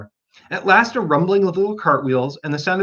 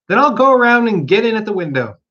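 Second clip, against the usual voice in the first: rabbit voice; 7 semitones higher, 5 dB louder than usual. Acted. Rabbit talking to itself.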